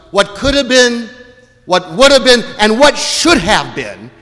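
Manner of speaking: high, loud, building to a cry; impassioned, preacherly anguish; anguished outburst. Acted. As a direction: voice Mary's racing thoughts, pitch climbing into near-shouts. Imagines Mary's racing, anguished thoughts.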